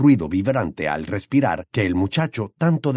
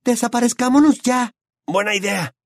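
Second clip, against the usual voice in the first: put-on higher, urgent young voices; urgent young friends, a bit higher; lightly voiced teenagers; 12 semitones higher, 3 dB louder than usual. A narrator's reading. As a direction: press the escape, anxious. Hermione and Ron voiced.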